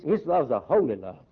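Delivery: ordinary delivery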